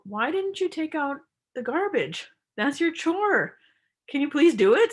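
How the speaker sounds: name the chore, not the person; firm request. Shown as a demonstration. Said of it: "So you would say".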